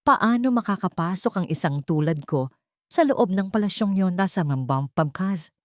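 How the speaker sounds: ordinary delivery, nothing marked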